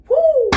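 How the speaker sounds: loud high whoop of excitement; excited whoop. Real, not acted. Reader whooping at the cards, loud high whoop. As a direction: let out a big thrilled woo. Excited exclaim mid-reading.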